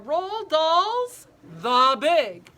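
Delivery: drawn-out, slow showy title reading; dramatic title announcement. Acted. Teacher to children, slow dramatic title announcement. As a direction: announce the book like a grand reveal.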